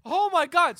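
high-pitched imitation of tearful panic; mock crying outburst. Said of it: "starts crying".

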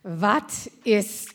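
put-on effortful English in a German accent; German-accented broken English. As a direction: imitate a German teacher trying out English. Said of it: "doesn't speak English, but she tries".

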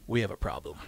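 low, grave officer's warning; a hint of a serious cop; stern cop. Police officer confronts teacher.

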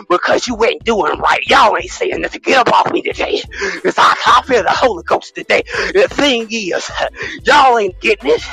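mock preaching. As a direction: whip the congregation up like a fired-up preacher.

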